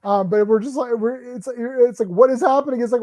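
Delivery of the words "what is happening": high, loud incredulous question; hammed-up bafflement; mock disbelief. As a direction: throw it out baffled. Reacting to scene.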